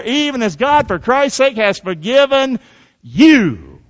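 scripture reading. Acted. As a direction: proclaim the verse high and strong to the congregation. Reads scripture aloud to congregation.

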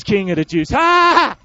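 loud mocking shout with a laugh; jeering shout. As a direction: jeer the sign like a cruel soldier. Soldiers jeering at the sign.

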